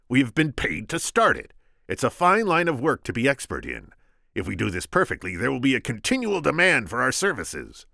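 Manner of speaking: high, loud Vizzini voice; squeaky scheming Sicilian. Acted. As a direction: pitch him up, sharp and cocky.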